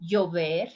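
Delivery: Llover as "yover"; soft y pronunciation. Shown as a demonstration. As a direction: start Llover on a gliding y. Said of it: "with the three different forms".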